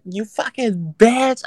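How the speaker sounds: cussing her out through the window; retold cussing out. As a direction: drag out the insult at her. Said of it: "fucking was like".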